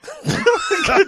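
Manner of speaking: giggling high; high giggle. Real, not acted. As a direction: giggle helplessly. Laughs, can't do the call.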